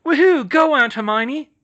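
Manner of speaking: loud, high whooping cheer. Acted. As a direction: cheer her aunt on like a fan. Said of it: "Nadia cheered".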